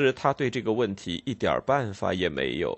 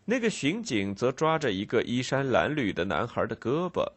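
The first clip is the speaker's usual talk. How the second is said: plain reading voice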